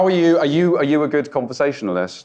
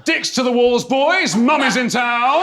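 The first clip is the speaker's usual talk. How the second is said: mock alarm call; 9 semitones higher, slower than usual. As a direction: yell it out like a lookout raising the alarm.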